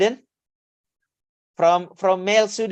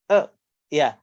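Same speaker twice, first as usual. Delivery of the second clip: ordinary talk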